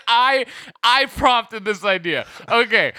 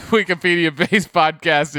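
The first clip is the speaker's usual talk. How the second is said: ordinary talk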